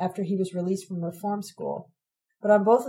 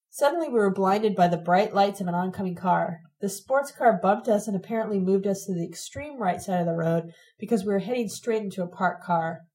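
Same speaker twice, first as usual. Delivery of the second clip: ordinary delivery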